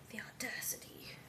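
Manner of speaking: hushed, scandalized whisper; whispered mock outrage.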